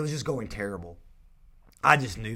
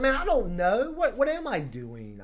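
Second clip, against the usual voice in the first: much higher and louder, a nervous retold blurt; flustered panic; 12 semitones higher, 7 dB louder than usual. Nervous agent answering a homeowner's question, in a high panicked blurt. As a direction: act out panicking in the interview, slowing on the second question.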